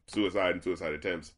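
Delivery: ordinary talk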